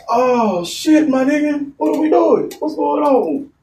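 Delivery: high, slowed disbelieving exclaim; mock disbelief. Storyteller reacting in disbelief to friend, high. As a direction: sell the shock, up high.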